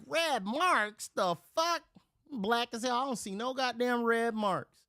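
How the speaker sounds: high, squawking disbelief; incredulous outrage. Retold or imitated. Man disputing police about red marks, incredulous. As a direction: throw it back at them, high and outraged.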